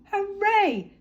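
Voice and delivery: high loud cheer; shouted. Acted. Little Beaver and friends cheering together, shouting. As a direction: cheer out with joy. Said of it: "shouted Little Beaver".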